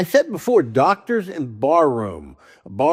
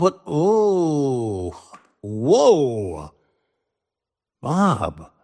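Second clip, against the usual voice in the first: startled; much slower than usual. Retold or imitated. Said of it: "she looks up and says".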